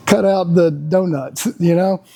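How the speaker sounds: usual speaking voice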